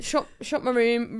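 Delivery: usual speaking voice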